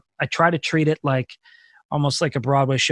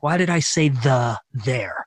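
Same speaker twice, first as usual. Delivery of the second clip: nitpicking self-doubt; 2 semitones lower, slower than usual. Retold or imitated. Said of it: "And like".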